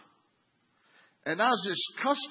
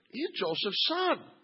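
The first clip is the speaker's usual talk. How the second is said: scoffing; 11 semitones higher than usual. Retold or imitated. Mocking the townspeople.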